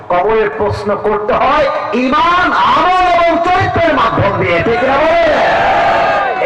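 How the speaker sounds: high, shouted sermon climax; full-force preaching. Acted. Preacher drives home his point to the crowd, shouting.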